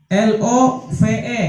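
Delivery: L O V E letter by letter, slow; spelled out slowly. Shown as a demonstration. Teacher spelling a word slowly for students. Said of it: "Tulisannya apa?".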